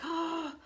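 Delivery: high, frustrated "oh" on a breath; pent-up groaning sigh, pitched high; exasperated gasp. Real, not acted. Showing how frustrating it was.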